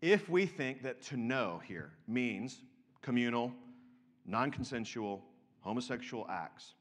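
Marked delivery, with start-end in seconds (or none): none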